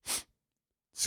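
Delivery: short imitated sniffle; a single quick sniff, acted. Student breaking the exam silence, quick mock sniff. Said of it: "quick sniff".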